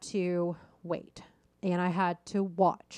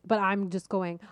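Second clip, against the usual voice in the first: usual speaking voice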